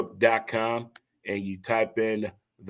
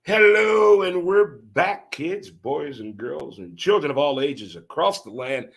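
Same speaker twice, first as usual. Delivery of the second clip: booming, stretched host hello; big show welcome; 5 semitones higher, 5 dB louder, slower than usual. Acted. Host welcoming kids back after the break, booming. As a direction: welcome everyone back like a ringmaster.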